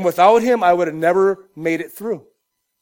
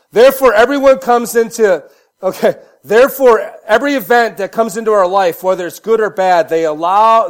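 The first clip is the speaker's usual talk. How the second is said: preaching; 6 dB louder than usual. Acted. Preaching at full force.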